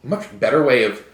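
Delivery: put-on soft, breathy Valley Girl voice; a hint of a Valley Girl. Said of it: "Why are you doing your Valley Girl voice?".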